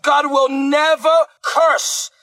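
ordinary talk

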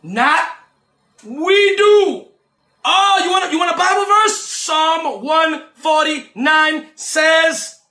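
rising, shouted sermon cadence with long held words; preaching at full force. Acted. Preacher preaching judgment of angels to his listeners, shouting. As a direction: preach it to the congregation, building to shouts.

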